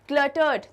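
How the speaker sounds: "क्लटर्ड" called out loudly, cl onset punched; loud, with a hard cl at the start of "क्लटर्ड"; teacher loudly stressing the cl in "क्लटर्ड"; stressed cl onset. Shown as a demonstration. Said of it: "तो क्ल का sound आ रहा है".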